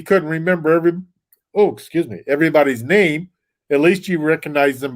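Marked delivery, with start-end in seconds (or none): none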